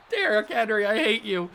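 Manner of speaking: laughing through mock despair; half laughing, half groaning the name; real laugh in the voice; laugh-crying. Real, not acted. Laughing at opponent's big run.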